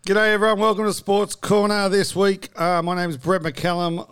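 upbeat sports show host intro. Acted.

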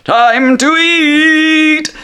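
very high, loud, long-drawn holler; Genie's gleeful yell. Acted. Genie announcing his cooked dish, drawn-out high shout. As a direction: cry it out high, stretching every word. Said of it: "and he says".

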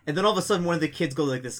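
ordinary talk, nothing marked

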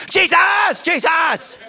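high, loud cry to Jesus; shouted prayer. Shown as a demonstration. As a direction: yell the name to break up a fight. Demonstrates praying loudly at spouse.

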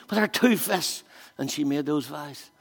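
usual speaking voice

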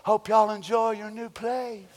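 a hint of a soft humble brother; lightly voiced meek neighbour giving way. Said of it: "nice, humble, sweet, gentle brother".

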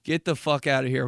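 ordinary delivery

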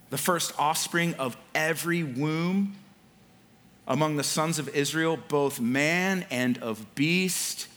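slow, measured Bible reading with long pauses; deliberate, weighty pulpit reading; scripture reading. Acted. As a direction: read the verse out solemnly, drawing out key words. Reads scripture aloud to congregation.

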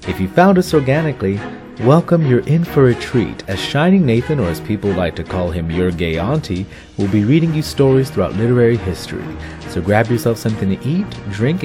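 upbeat podcast announcer read; announcer intro. Acted. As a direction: welcome listeners like a host.

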